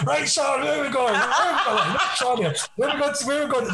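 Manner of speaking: high, laughing mock compere; [name] on coach mic. [name] on the coach microphone asking the crowd, laughing mock compere. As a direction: ham up a tour guide on the mic.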